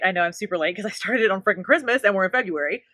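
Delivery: ordinary delivery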